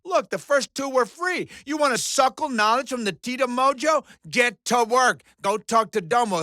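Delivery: ordinary talk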